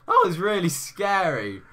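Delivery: loud, high voice crack; squeaky exclamation with a real crack; voice breaking. Real, not acted. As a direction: let the voice jump up and break. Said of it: "Just like my voice breaks just then".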